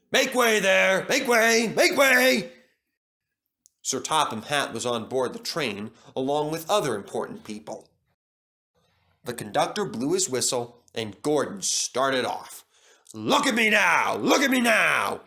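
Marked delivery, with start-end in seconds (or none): 0.1-2.5 building loud boastful calls, higher each time; a proud engine clearing the way, getting louder; showing off. Acted. Gordon showing off at the station, loud and boastful. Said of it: "He was showing off like anything". 13.1-15.2 loud, high boastful shout; proud shout. Acted. Gordon leaving the station, shouting proudly. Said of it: "he whistled".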